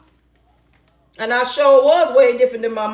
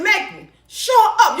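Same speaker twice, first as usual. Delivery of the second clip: high, taunting retort; mock quarrel; 9 semitones higher, slower than usual. Woman replaying argument with angry man, high mock bickering. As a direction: act out a bickering match, pitched high. Acts out back-and-forth yelling match.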